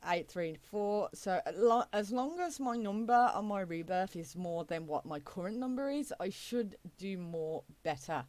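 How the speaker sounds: normal talking voice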